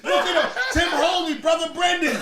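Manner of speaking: loud, high laughing protest; laughing shout. Real, not acted. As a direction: shout it while cracking up.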